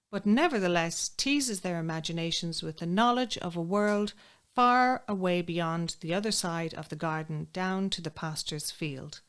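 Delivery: ordinary delivery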